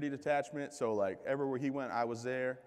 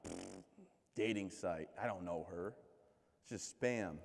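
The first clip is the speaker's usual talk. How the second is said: dismissive; 6 semitones lower, 7 dB quieter, slower than usual. Retold or imitated. Dismissive muttered reaction.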